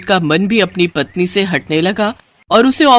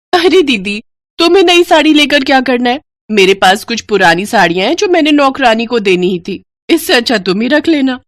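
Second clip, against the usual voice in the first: higher and fast, sneering; mocking young bride; 5 semitones higher than usual. Young bride offers her maid's old saris, higher and mocking. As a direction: toss off the insult lightly and fast.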